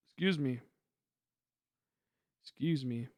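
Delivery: hiccuping between words; hiccups. Real, not acted. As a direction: say it through hiccups. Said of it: "I got the hiccups".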